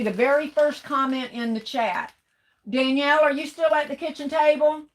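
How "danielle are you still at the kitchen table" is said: calling out. Real, not acted. Calling to daughter in another room.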